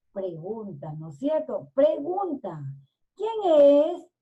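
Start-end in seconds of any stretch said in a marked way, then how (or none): 3.2-4.1 loud, high call to the door. A narrator's reading. Grandmother answers the knock, calling out. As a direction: raise the voice and call it out. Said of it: "Gritó la mujer".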